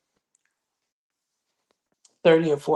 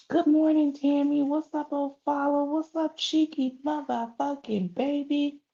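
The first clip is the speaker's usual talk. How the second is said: bright, much higher welcome to viewers; high singsong hello to commenters joining the live; high sing-song greeting; 11 semitones higher than usual. Real, not acted. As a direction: lift way up to greet each viewer by name.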